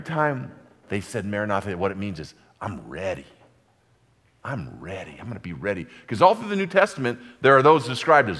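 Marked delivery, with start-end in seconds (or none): 2.6-5.9 much softer and lower, saying I'm ready; hushed vow. Retold or imitated. Preacher voicing the believer's vow to the congregation, hushed low. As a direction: breathe the words like a private promise.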